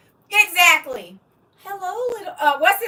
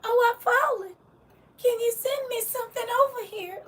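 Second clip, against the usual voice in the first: much higher frail call for help; life alert lady; 9 semitones higher, 4 dB quieter than usual. Retold or imitated. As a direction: cry out like a fallen old lady. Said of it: "that voice remind me of".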